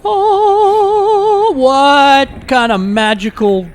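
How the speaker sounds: high, drawn-out, loud amazement; mock wonder. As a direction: marvel at it slowly, way up high. Marvels at magical shortcut to Starbucks.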